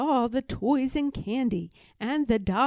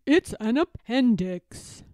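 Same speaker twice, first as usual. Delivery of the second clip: a louder, urgent doctor on the phone; doctor's urgent call; 5 dB louder than usual. A narrator's reading. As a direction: tell the nurse firmly and loudly. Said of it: "he said".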